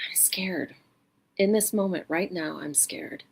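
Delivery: soft, unvoiced whispered admission; quiet acknowledgement. Shown as a demonstration. Guiding listeners to admit fear, hushed and soft. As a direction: let the fear be named gently. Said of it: "just acknowledge".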